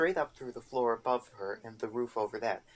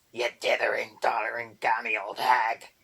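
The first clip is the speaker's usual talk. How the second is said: screaming boggart; 7 dB louder than usual. A narrator's reading. Boggart screaming insults at Erder, furious goblin shriek. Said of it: "the boggart screamed".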